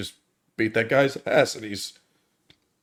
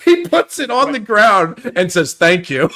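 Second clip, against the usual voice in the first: laughing; 8 semitones higher, 4 dB louder than usual. Real, not acted. Laughing at the scene.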